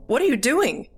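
high, swooping cry of disbelief; alarmed cry. A narrator's reading. Girl jumping away from grandpa, alarmed high cry. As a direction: demand it in high-pitched alarm. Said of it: "I cried".

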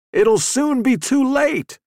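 high, loud, drawn-out shout. A narrator's reading. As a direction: shout a slow desperate warning, as if heard over a radio. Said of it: "He was shouting".

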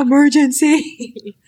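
high, stretched-out wail of a word; mock frustration. Woman insisting to absent boyfriend, mock-dramatic. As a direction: draw it out in hammed-up urgency. Hammed-up repeat of frustrated plea.

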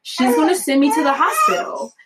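mildly hammed-up alarm; freaking out. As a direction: act out an inner freak-out. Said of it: "I'm freaking out mentally".

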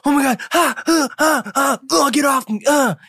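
high-pitched panicked freak-out; frantic mock squeals; super hyper dude. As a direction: play a hyper guy flailing and yelping, voice way up high. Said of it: "this super hyper dude who's just like".

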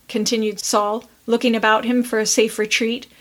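ordinary delivery

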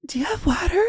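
breathless high whisper; panicked whisper asking friends for water. Retold or imitated. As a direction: gasp it out in a panic. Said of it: "I went out there like".

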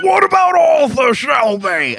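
high, slow shouted mock accent; over-the-top quote from a gangster drama; Peaky Blinders impression. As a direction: bellow it in a hammed-up put-on accent. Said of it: "not to always bring up Peaky Blinders".

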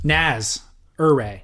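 Naz and Ure said as separate syllables, falling low; Naz, then Ure, with a pause between them. Shown as a demonstration. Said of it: "It's Naz".

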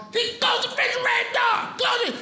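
very high, shouted order; dad yelling at the kid over the open fridge; angry dad shout. Retold or imitated. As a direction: yell at the kid to shut the door. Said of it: "got mad at you if you opened the refrigerator".

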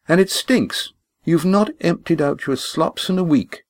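high, indignant complaint; scolding. Acted. Lindy chiding Walt about his kitchen, high and indignant. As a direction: scold him for the mess.

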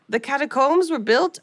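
lightly voiced French tour guide; French accent. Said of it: "wow, that was my French accent".

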